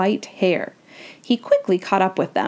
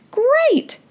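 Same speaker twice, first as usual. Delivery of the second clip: put-on very high girlish exclamation; delighted cheer. A narrator's reading. Annie hears Sylvia will join them, delighted. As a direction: pitch it way up, like a thrilled child.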